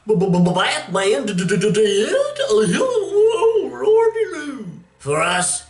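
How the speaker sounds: high, hammed-up stammering loon; comic mock-wailing clown voice; stuttering clown. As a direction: stammer it out like a cackling madman. Clown Two-Face stuttering, hammed up.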